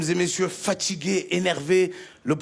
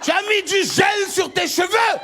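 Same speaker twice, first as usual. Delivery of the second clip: fiery imam; 9 semitones higher than usual. Retold or imitated. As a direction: rail at them like a fierce preacher. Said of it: "Il nous a dit, le imam".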